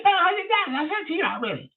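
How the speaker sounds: high squeaky voice for the caller; Leonard's voice. Retold or imitated. Retelling the strange caller, high mimicking voice.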